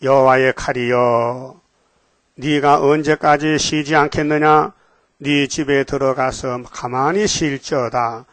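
slow, measured reading of the verses; solemn scripture reading. Acted. Preacher reading Bible verses to the congregation, slow and solemn. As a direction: intone each verse slowly and gravely.

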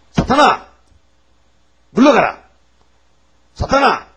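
high, loud commands; exorcising shout. Acted. Preacher leading the rebuke of Satan, shouting. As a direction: cast him out with each cry.

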